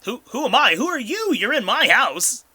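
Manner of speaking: shrill outraged squeak, loud; outraged, voice jumping up high; indignant high yell. Acted. GM voices indignant invisible man.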